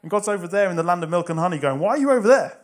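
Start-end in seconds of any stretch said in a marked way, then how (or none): 1.8-2.6 loud, high call across a distance. Acted. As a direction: call over to someone far away, raised and incredulous. God calls across to him.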